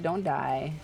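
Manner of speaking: ordinary delivery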